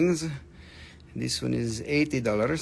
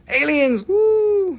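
high, loud gleeful whoop about aliens; excited whoop; 6 dB louder, much slower than usual. Real, not acted. Host whooping excitedly to viewers about aliens. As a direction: shout it out with glee, way up high.

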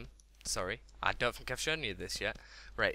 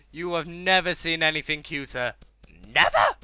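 loud, higher-pitched insistence; emphatic raving; 4 semitones higher, 11 dB louder than usual. Real, not acted. Gamer raving about the cute piggy, emphatic. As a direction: insist loudly, punching the last word.

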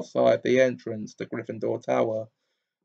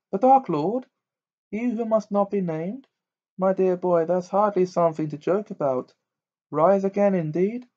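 much higher, startled Trelawney; startled seer; 7 semitones higher than usual. A narrator's reading. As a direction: recoil as if offended.

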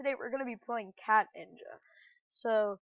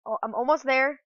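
ordinary delivery

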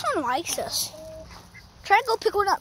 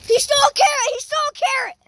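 high, loud excited outcry; excited shout; 9 semitones higher, 9 dB louder, faster than usual. Real, not acted. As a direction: call out the theft, loud and high. Calling out a carrot theft.

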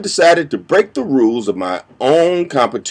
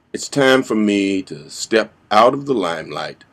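usual speaking voice

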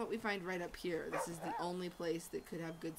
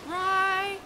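loud; 10 semitones higher, 13 dB louder than usual. Real, not acted. Emphatic push before trying.

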